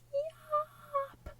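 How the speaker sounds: very high sudden yelp; one high shout to be heard; Jojo's yelp. Acted. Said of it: "Jojo goes".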